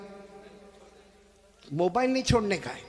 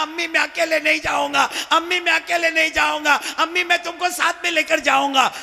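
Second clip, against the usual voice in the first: put-on child's cry; 7 semitones higher, 4 dB louder than usual. Child prophet crying at mother's grave.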